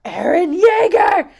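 high, loud exasperated cry; fan outcry. Retold or imitated. Fans react to bullshit happening, loud exasperated cry. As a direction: wail the name like a fed-up fan. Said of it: "be like".

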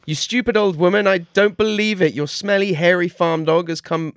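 high, loud raging devil; furious devil. Acted. The outwitted devil screams at the old woman. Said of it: "he screamed".

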